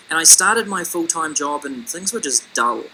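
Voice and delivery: ordinary talk, nothing marked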